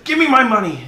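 loud, high shouted demand; high-pitched yell, pitch falling as it goes; angry demand. Acted. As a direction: shout it like a creditor out of patience. Acted creditor confronts the debtor.

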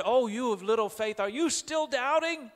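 acted rebuke, pitch climbing high into the question; chiding disbelief. Jesus asks doubting Peter in the storm, chiding. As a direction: chide them, rising to an incredulous question.

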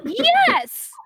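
high, loud yelp; exasperated retort. A narrator's reading. Wally answering Artemis's sarcasm, exasperated high yelp.

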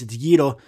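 "gyro" said YEE-ro with tapped r; Greek pronunciation. Shown as a demonstration. Correcting people who mispronounce it, "gyro" in Greek, YEE-ro. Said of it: "if I hear anybody say gyro".

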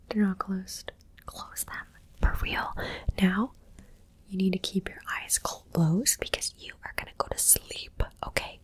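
normal talking voice

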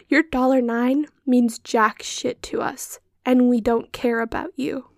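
loud, punched-out words with hard pauses; forceful, deliberate telling-off; emphatic, close-mic rant. Real, not acted. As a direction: drive every word home right into the microphone. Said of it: "I need this to be expressed".

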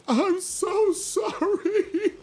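very high, slow wailing sob; hammed-up crying; sobbing apology. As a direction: wail the apology through tears. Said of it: "He's like".

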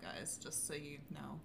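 hushed aside to listeners; whispering. Real, not acted. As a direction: drop to a breathy whisper.

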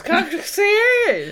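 loud, high, drawn-out mock blurt; high garbled squawk. Shown as a demonstration. Co-host mocks her own muddled sentence, high drawn-out squawk.

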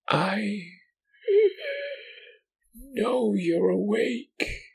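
very high, slow, strained wheeze; wheezing creature whisper. Acted. Creature at his ear, slow wheezing whisper. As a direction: drag the words out through pained breath. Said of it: "It whispered to me, between pained wheezing".

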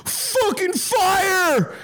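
high, drawn-out helpless wail; mock a useless bystander crying out, very high and slow; long high-pitched mock holler; mock alarm cry. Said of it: "stand on the side of the road and go".

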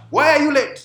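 barked officer's question, high and loud; drill sergeant. Acted. Pastor voices commanding officer.

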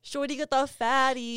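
lightly put-on bro catcall; a touch of frat-bro swagger; bro alter ego. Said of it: "I'm coming out of my alter ego now".

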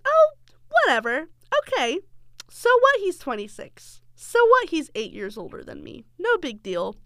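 high, airy brush-off from her younger self; mock carefree, waving it away; breezy dismissal. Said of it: "me being who I was, I was just like".

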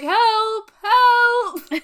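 high loud laughing "Help!"; mock cry for help. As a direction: yell for help, half laughing. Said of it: "and he's like".

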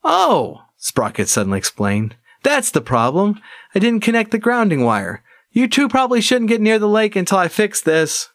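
high, quick tinkerer gnome; a chirpy little gnome realizing his mistake; excited gnome voice. Acted. Gnome Sprocket's voice.

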